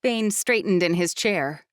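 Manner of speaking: plain reading voice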